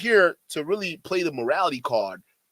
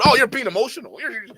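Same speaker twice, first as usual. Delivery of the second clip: mocking outrage; 7 semitones higher, 7 dB louder, faster than usual. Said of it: "when they can't answer the question".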